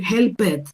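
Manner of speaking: HELPED as "hel-pet", full E; wrong two-syllable ending. Shown as a demonstration. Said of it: "Pero yo no puedo decir".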